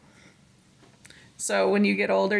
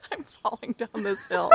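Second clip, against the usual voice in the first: laughing. Real, not acted. Giggling fit on a hill.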